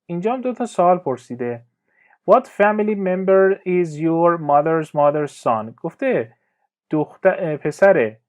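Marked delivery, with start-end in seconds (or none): 2.3-5.8 very slow, careful teacher reading; slow clear example. Shown as a demonstration. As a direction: spell each word out slowly for learners. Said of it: "teacher reads exercise question". (جملهٔ نمونه را خیلی آهسته و شمرده بخوان)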